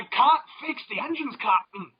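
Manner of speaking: high, urgent mock-Irish ship engineer; hammed-up Irish accent, pitched high. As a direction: plead to the captain in an Irish lilt. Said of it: "a wee bit of an Irish accent".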